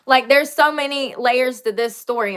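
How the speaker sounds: ordinary talk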